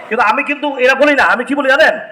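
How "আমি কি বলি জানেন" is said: normal talking voice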